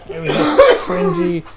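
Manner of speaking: high, loud cry of disgust over the story; exasperated outburst. Real, not acted. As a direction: blurt it out in disgust.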